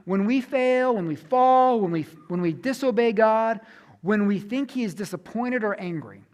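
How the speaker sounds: ordinary delivery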